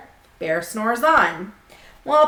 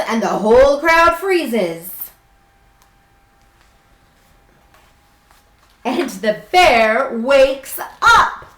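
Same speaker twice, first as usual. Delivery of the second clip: slow, lilting storybook voice; reading to children; 3 semitones higher, slower than usual. A narrator's reading. Teacher reading a picture book to her class, gently storytelling. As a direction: read it like bedtime story time.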